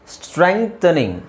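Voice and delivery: normal talking voice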